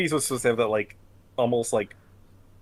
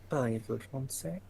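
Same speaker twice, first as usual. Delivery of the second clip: mock French accent; 4 semitones higher, 6 dB quieter than usual. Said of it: "Kryl is supposed to be French".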